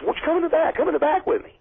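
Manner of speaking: a hammed-up high boss calling him to the back; imitating the boss. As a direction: put on a high, coaxing boss voice. Boss invites him backstage, retold.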